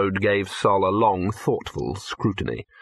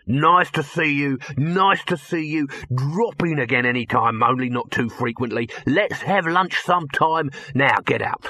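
higher, gushing brush-off dropping to dismissal; brash hustling producer; 4 semitones higher than usual. Acted. Dibbler hurrying Victor out, brash showman's gush. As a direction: gush the farewells, then drop flat.

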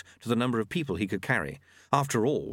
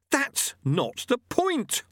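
hotly; much slower than usual. A narrator's reading. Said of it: "said Lobsang hotly".

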